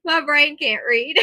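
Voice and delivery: high, loud words broken by giggles; laughing. Real, not acted. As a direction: squeeze the words out through laughter. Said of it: "I'm laughing at everything".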